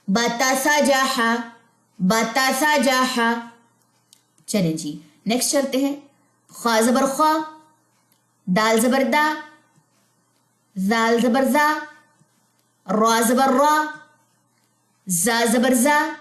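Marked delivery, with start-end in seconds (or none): 0.1-3.3 slow drill reading. Shown as a demonstration. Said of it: "teacher models syllable reading". 6.5-16.2 each syllable set apart, slightly raised; careful letter-by-letter recitation; teacher spelling out letters for the class; spelling drill. Shown as a demonstration.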